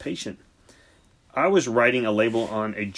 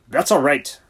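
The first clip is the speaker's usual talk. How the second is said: snapped; 4 semitones higher, 7 dB louder than usual. A narrator's reading. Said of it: "snapped Siegfried".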